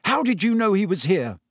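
high, demanding question; heated, accusing tone; furious. A narrator's reading. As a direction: demand an answer with contained anger.

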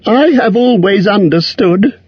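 high, timid hobbit squeak; frightened squeak. A narrator's reading. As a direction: squeak it out in fear. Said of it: "in a frightened squeak".

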